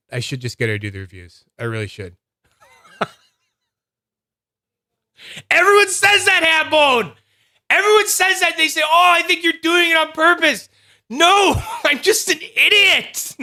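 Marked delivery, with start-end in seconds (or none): 5.5-13.4 very high, loud comic wail; over-the-top falsetto protest; mock hysterical outburst. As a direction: squeal it in hammed-up exasperation. Mock outburst at being corrected.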